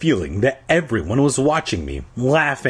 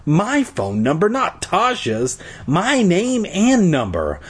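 dawning horror, pitched up; disbelief; 6 semitones higher than usual. A narrator's reading. Repeats in disbelief.

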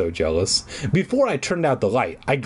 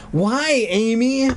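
high, insistent demand; acted high-pitched accusing question; 8 semitones higher, slower than usual. A narrator's reading. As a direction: demand an answer, voice raised in pitch. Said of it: "Sarah demanded".

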